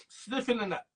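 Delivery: usual speaking voice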